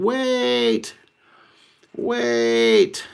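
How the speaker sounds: high, frantic "wait, wait"; panicked pizza guy. Retold or imitated. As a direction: plead as he runs off. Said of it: "the pizza guy's going".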